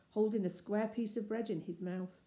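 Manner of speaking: ordinary delivery, nothing marked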